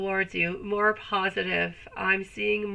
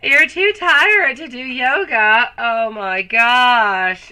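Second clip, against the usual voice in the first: slow, louder, drawn-out oh my gosh; mock shock; 3 semitones higher, 8 dB louder, much slower than usual. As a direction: stretch out a theatrical gasp of disbelief. Mock-shocked reaction to comment.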